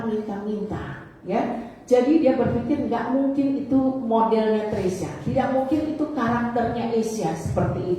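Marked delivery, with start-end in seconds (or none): none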